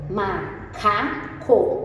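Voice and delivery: "Macaco" stretched out syllable by syllable; teacher modelling the word "Macaco"; slow sounding out. Shown as a demonstration. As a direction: say "Macaco" very slowly, each syllable clear. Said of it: "nós temos um macaco".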